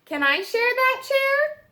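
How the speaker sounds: high, cheerful animal asking to share; squeaky little critter wanting a seat. Acted.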